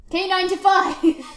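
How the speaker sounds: high, loud burst on the code; loud exclamation. Real, not acted. Gamer repeats the code K ninety-five, loud exclamation. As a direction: call it out loudly.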